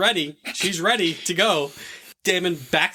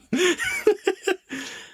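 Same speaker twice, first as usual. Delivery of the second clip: high burst of laughter. Real, not acted. Host laughing at the forced marriage, high laugh.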